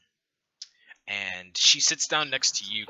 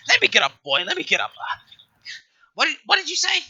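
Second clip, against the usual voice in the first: put-on louder old woman rising higher to a startled question; straining old woman; 9 dB louder, faster than usual. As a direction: heave up off the cane and demand it.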